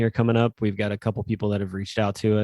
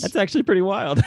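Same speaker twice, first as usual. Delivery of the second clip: high, amazed reaction; 10 semitones higher, 3 dB louder than usual. Retold or imitated. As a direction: sound genuinely wowed. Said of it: "I'm like".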